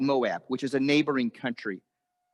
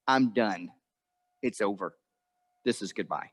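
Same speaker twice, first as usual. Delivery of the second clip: low, quiet retold resignation; acted weary finality, dropping away; resigned; 6 semitones lower, 4 dB quieter than usual. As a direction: let it sink softly into a final farewell. Naomi resigned, sends daughters-in-law away.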